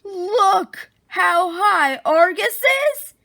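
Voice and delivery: loud, slow, excited exclamation. Acted. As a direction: show off the giant chick with big excitement. Sally boasts about her huge chick.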